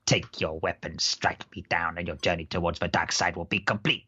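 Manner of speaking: low, menacing Palpatine voice; tempting devilish whisper. Retold or imitated. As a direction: tempt them toward the dark side, slow and low. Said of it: "it went a bit Palpatine there".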